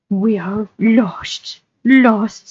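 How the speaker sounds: slow, drawn-out creature voice; Gollum, woeful. Acted. Captive pleading he is lost, woeful Gollum voice. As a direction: lament slowly as a wretched creature.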